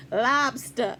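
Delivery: Maine "Lobster." dropping the r, lahbstah; Maine accent. Shown as a demonstration. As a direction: try the Maine way: "Lobster." without the r. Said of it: "Say it the way they say it in Maine".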